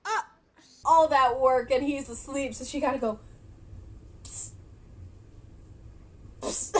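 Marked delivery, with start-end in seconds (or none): 4.2-6.8 short sharp "psst" to wake someone; voiceless psst at a sleeper; attention-getting psst. Retold or imitated. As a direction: hiss a quick "psst". Acting out waking sleeping man.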